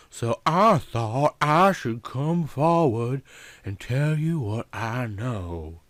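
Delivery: slow Southern drawl, lingering on words; lazy Southern woman explaining. Acted. Yvonne tells the detective why she came, in a Southern drawl.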